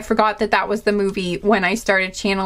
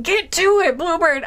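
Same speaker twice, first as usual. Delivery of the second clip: impatient viewer; 9 semitones higher than usual. Retold or imitated. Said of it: "You're probably just like".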